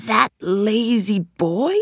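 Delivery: slow, drawn-out disdain; lightly voiced scornful magician, drawling the words. A narrator's reading. Magician hears of Aladdin's good fortune, scornful. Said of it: "he said".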